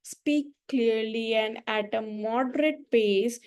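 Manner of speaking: painfully slow, drawn-out words; very slow demo. Shown as a demonstration. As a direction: stretch every word to show a boring slow pace. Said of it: "मैं अगर ऐसे बात करूंगी".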